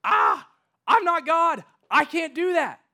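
high, loud, frantic cry of a king in despair; panicked king. Retold or imitated. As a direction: wail it like a king tearing his robes. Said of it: "He's like".

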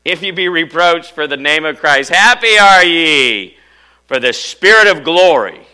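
loud, high verse with HAPPY ARE YE stretched out; exultant proclamation. Shown as a demonstration. Preacher rereading the verse to congregation, exultant shout. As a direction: belt out the scripture, drawing out the key phrase.

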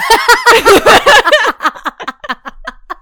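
high, loud burst of laughter; big laugh. Real, not acted. Laughing hard at her friend's paranoia joke.

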